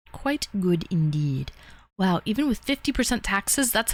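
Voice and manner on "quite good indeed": low, slow mock-refined "quite good indeed"; a hint of a toff; mock posh aside. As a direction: savor it like a snooty connoisseur.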